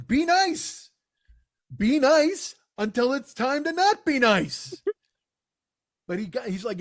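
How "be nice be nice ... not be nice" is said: higher, very slow Dalton line, each word laid down; lightly voiced cool-headed Dalton, spoken slowly and high; Dalton quote. Quoting Dalton on the bouncer rules, slow and higher. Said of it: "I quote Dalton".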